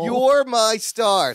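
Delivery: grand pronouncement. Retold or imitated. Director picks Larry dramatically.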